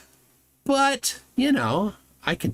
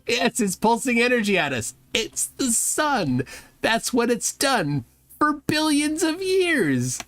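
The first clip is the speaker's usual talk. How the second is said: mock exasperation; 8 semitones higher than usual. Host answers a clueless clip about the sun, mock exasperation.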